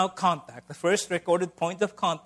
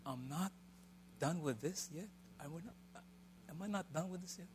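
muttering to the mirror; 15 dB quieter than usual. Retold or imitated. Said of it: "I'm going like".

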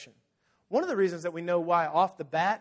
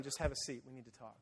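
low, soft, settling voice; calm him down, dropping almost to nothing; quiet reassurance; 5 semitones lower, 13 dB quieter, faster than usual. Retold or imitated. Jesus gently cornering Peter.

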